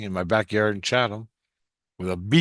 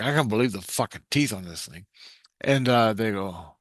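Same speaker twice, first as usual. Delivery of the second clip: ordinary talk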